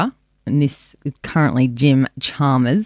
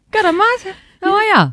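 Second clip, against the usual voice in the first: much higher, hammed-up Aussie greeting; high Aussie accent. Co-host repeating her greeting, higher and more hammed-up Aussie. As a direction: pitch it up and lay on the Aussie. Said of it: "I was like".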